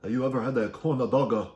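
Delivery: put-on Korean accent with added vowels; mock Korean accent. Shown as a demonstration. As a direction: ask it in an exaggerated Korean accent. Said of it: "say it in a Korean accent".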